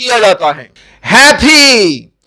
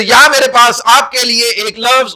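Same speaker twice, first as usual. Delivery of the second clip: usual speaking voice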